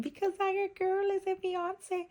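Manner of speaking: high excited squeal; squealy high voice. Real, not acted. Squeals about being engaged.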